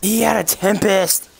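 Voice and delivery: high, loud, slow indignant yell; furious gamer shout at the enemy's Tempest; outraged shout. Real, not acted. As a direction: yell it in disbelief. Outraged at losing a kill.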